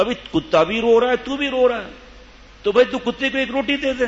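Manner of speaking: high, pleading voice of a passer-by; passer-by pleading with the weeping man to feed his dog, high voice; pleading stranger. Retold or imitated.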